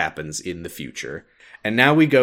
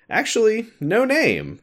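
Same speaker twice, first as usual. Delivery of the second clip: much higher, lightly sing-song teasing; playful master voice; 13 semitones higher than usual. Retold or imitated. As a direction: tease the answer out like a whimsical master. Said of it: "and the master says".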